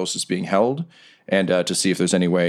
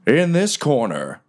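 ring announcer; 7 semitones higher than usual. Acted. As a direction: savor the gory line like a showman. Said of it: "Riker gets back on the mic".

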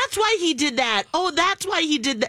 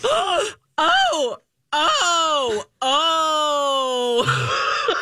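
startled; much slower than usual. Retold or imitated.

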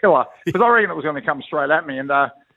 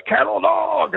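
trainer's yell; 12 semitones higher, much slower than usual. Retold or imitated. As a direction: bellow the call from the sideline. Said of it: "our trainer runs on and goes".

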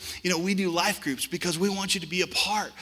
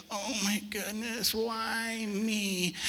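mock whimper; 6 semitones higher, 5 dB quieter, much slower than usual. Imitating whiner on floor crying.

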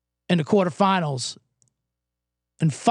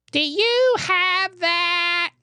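put-on drawn-out ditzy drunk girl voice, higher; high, slow drunk girl; 11 semitones higher, much slower than usual. Mocking drunk girls ordering at the bar, slow and high. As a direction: slur it slowly like a tipsy girl. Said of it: "they're like".

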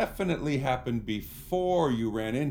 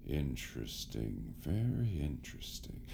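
sinister interest; 5 semitones lower, 7 dB quieter than usual. Acted. Villain intrigued by a bite.

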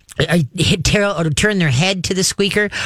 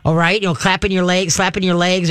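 ordinary delivery

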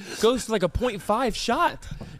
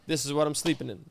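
lower, softer throwaway; quiet aside; 7 semitones lower, 6 dB quieter, faster than usual. Retold or imitated. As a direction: drop it low and soft. Quoting own packing, dropped aside.

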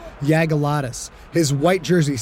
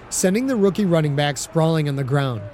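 plain reading voice

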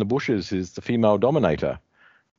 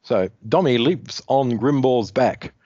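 ordinary delivery

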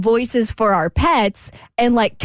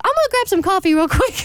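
high cartoon doggy voice; 9 semitones higher than usual. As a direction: chirp it like the pet would. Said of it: "You talk to Romeo in your doggy voice?".

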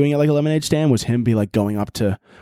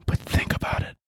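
whisper; 7 dB quieter than usual. Acted. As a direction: whisper it like a secret plan. Conspiratorial aside, unvoiced.

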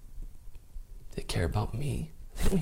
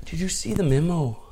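put-on a light, self-doubting Canadian accent, pitched up; Canadian teacher accent; 9 semitones higher than usual. Canadian teacher calling a student over, lightly Canadian accent. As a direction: imitate his Canadian teacher, not too sure of it. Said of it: "I don't know if this accent's terrible".

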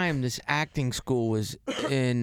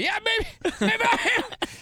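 laughing; slower than usual. Real, not acted.

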